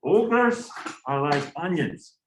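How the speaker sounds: badly faked Scottish brogue, drawn out; terrible Scottish accent. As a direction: do a hammed-up bad Scottish accent. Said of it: "that's my terrible Scottish accent".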